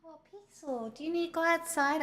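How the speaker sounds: soft, sing-song pet talk; talking to the dog. Real, not acted. Owner softly coaxing her poodle to go outside. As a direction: coax the dog gently.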